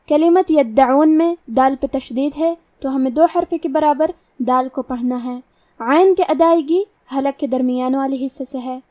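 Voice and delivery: ordinary talk, nothing marked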